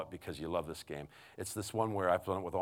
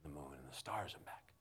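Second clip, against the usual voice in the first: low, near-whispered affection; tender bedtime voice; 5 semitones lower, 14 dB quieter than usual. Retold or imitated. Dad answering his daughter in the bedtime game, hushed and tender. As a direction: murmur it lovingly, very soft.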